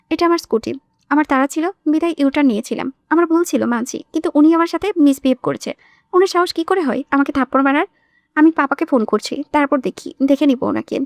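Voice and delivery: plain reading voice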